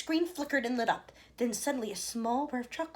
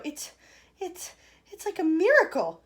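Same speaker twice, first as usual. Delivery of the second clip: stammering awe; 9 semitones higher, 10 dB louder than usual. A narrator's reading. Grandpa Joe stammering in awe.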